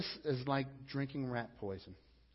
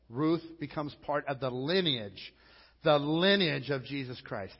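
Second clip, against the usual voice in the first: louder preaching with building emphasis; preacher declaring God's love for the Moabite woman; full-force preaching; 7 dB louder than usual. Acted. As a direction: proclaim it to the whole church.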